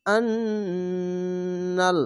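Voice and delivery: আন্নাল with a long held nasal on the doubled ন; held ghunna. Shown as a demonstration. Teacher showing the compulsory ghunna, slow recitation. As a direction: stretch the ন in আন্নাল into a nasal hum. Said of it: "ওয়াজিব গুন্না".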